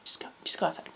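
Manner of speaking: insistent whisper. Retold or imitated. Friend urging Carly outside, insistent whisper. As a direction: push her out the door under your breath. Friend hurrying her out quietly.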